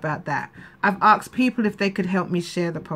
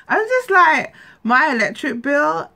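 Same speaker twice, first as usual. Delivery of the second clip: still teary; 8 semitones higher, 5 dB louder than usual. Real, not acted. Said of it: "I'm gonna try not to cry".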